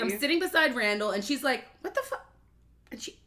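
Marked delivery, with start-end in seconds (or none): 1.8-2.3 hushed-up imitation of a shocked friend; high, stunned little quote; mock shocked friend. Friend spotting the marks at brunch, mock shocked.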